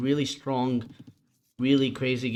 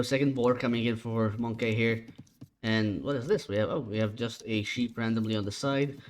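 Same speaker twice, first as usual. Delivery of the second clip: usual speaking voice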